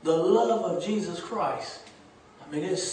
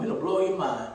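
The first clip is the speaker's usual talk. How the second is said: ordinary talk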